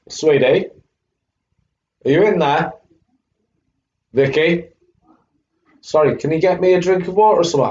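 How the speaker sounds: calling out. Real, not acted. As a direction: call through the house for help.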